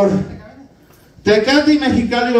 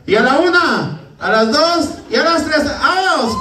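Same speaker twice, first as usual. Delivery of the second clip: rising count, shouted on vamos; countdown shout; 5 semitones higher than usual. Real, not acted. Host rallying the audience to drink, shouting.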